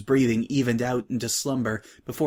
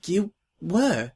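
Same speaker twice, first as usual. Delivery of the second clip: higher, slow surprised question; lightly voiced timid filly; 6 semitones higher than usual. New girl to Scratch, a hint of timid surprise.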